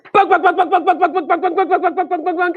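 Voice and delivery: high, machine-gun string of bonks; cartoon balls bouncing off a head; rapid bonk noises. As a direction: rattle off impacts evenly, up high. Many balls bonking the snake.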